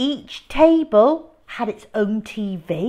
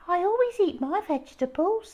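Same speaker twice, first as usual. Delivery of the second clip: put-on high prissy child voice, quick; goody-goody smugness; prim little brother; 10 semitones higher, faster than usual. A narrator's reading. Peter asking Mum for a reward, high prissy voice. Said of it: "said Peter".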